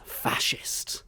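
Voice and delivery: unvoiced mutter of Fascist; whispered insult. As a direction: hiss Fascist under the breath. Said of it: "she looks up and goes".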